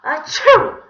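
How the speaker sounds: loud, high achoo; acted sneeze. A narrator's reading. Villain with pepper pie in his face, sneezing. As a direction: burst out with a big sneeze. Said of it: "he began to sneeze".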